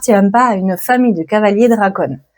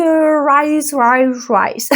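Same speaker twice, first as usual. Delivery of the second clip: English accent; 3 semitones higher, much slower than usual. Shown as a demonstration. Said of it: "Désolée pour mon accent anglais".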